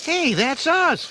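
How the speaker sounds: excited shout. A narrator's reading. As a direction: point and holler at the screen. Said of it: "Donatello shouted".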